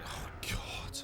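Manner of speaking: breathy whispered oh God; a real whispered groan at the name. Real, not acted. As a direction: let it out under your breath. Groans at a terrible track name.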